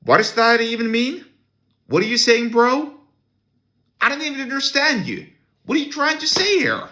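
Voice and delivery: very high, baffled mock outrage; exasperated disbelief. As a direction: squeak out your disbelief at the sentence. Baffled by the dean's sentence.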